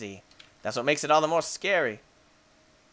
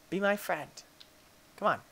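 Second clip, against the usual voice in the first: ordinary talk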